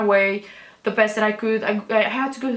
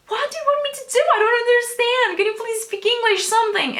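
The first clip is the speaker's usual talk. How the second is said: retold pleading, much higher and close to crying; near tears; 13 semitones higher, faster than usual. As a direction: voice cracking in helpless frustration. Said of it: "and I was like".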